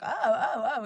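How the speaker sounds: repeated retold "ow"-like oh; re-enacted pained "oh"s. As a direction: imitate yelping at a needle jab. Re-enacts reacting to painful needle.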